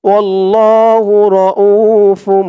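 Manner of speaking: slow, drawn-out Quranic chant; tajweed recitation. Shown as a demonstration. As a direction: recite it slowly with long held vowels. (ধীরে টেনে কুরআন তিলাওয়াত)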